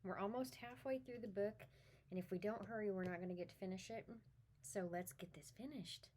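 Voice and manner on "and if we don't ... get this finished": usual speaking voice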